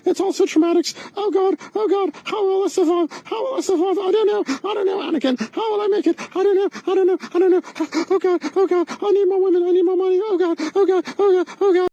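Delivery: high, whiny melodramatic wailing; mock despair. Comedian mocking a begging grifter, high melodramatic whine. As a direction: ham up helpless anguish.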